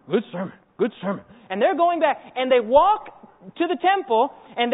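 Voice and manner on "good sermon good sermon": low brute voice, won over; a lumbering guard grunting approval; put-on low ogre praise. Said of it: "Here go these guards".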